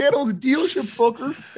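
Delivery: high-pitched mock brat; hammed-up spoiled son's boast; bratty kid. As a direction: throw a high, petulant threat over your shoulder. Repeating the spoiled kid's threat.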